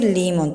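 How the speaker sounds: "limón" said as LÍ-mon; stress on first syllable. Shown as a demonstration. Teacher warns against the unaccented form, "limón" said LÍ-mon. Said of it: "Yo no puedo decir limón".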